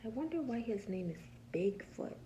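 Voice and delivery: usual speaking voice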